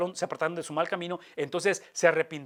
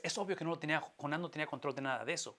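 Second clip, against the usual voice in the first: softer, quick aside to the crowd; quiet aside; 9 dB quieter, faster than usual. Real, not acted. Preacher tells his congregation that Jonah controlled nothing, quietly. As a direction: drop the voice and hurry through it.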